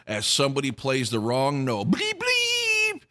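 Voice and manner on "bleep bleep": mock bleep noise. Imitating wrong notes in show.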